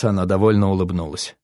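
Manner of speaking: ordinary delivery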